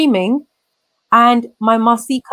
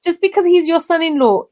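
much higher, fast, disbelieving retold outburst; incredulous outrage; 8 semitones higher, faster than usual. To her grandmother about the son-in-law, fast incredulous anger. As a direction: fire it back fast and high, can't believe it.

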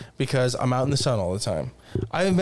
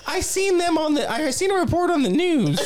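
laughing mom impression; 14 semitones higher than usual. Mom voiced, laughing retelling.

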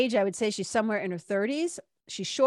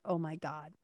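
low, soft, exasperated groan of words; quietly exasperated, hands over your face; muted exasperation; 5 semitones lower, 8 dB quieter than usual. Retold or imitated. Reacting to the mess left behind, muted exasperation. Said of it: "in exasperation, just, like, hands on my face".

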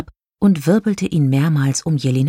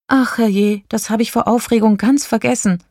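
higher-pitched, flustered oh-dear; apologetic; 6 semitones higher than usual. A narrator's reading. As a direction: apologise as if you just remembered. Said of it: "entschuldigte sich Lilifee".

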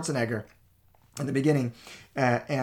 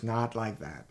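laughing; 3 semitones lower, 5 dB louder than usual. Real, not acted. Laughing at his past self.

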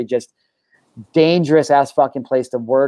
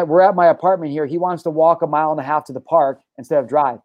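normal talking voice

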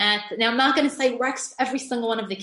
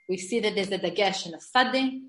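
usual speaking voice

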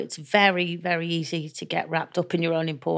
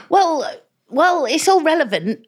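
pitched-up defensive squeak; high defensive whine; 11 semitones higher, 5 dB louder than usual. Retold or imitated. To the makeup artist Lee, a high defensive whine. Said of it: "literally I'd go".

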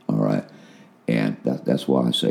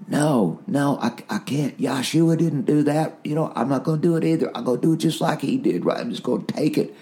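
mild stubborn resolve; 14 semitones higher than usual. Retold or imitated. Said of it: "and I thought".

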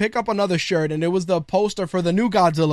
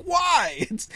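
high-pitched, drawn-out retort; hammed-up high "why"; mock protest; 9 semitones higher than usual. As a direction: object in a much higher voice, playing up the protest. Retold protest to wife's objection.

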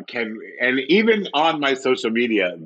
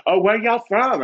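put-on Southern accent; 11 semitones higher than usual. Woman greets fellow Southerners, Southern accent. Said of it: "woman had a Southern accent".

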